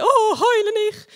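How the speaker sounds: high, loud, drawn-out yell at a crying person; mock shout. Yelling at someone crying, a comic overloud high shout. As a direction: bellow it far too high and loud, hammed up. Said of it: "und ich dann schreie".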